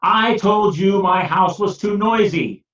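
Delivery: angry complaint. Acted. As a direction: vent your fury at the wise man. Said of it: "Peter was very angry".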